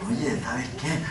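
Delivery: low, hushed, insinuating; very soft and low-pitched temptation; soft coaxing devil. Retold or imitated. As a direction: murmur it softly, like a tempter. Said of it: "le habló bien suavecito diciéndole".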